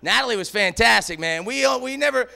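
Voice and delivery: ordinary talk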